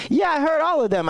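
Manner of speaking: high, matter-of-fact clerk; Cheryl's voice. Retold or imitated. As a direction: answer brightly like the clerk. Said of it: "She's like".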